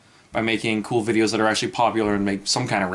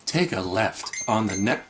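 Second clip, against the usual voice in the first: robotic navigation voice; flat, lower sat-nav voice; GPS voice; 4 semitones lower, 4 dB quieter, slower than usual. Shown as a demonstration. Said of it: "I'm talking like a GPS".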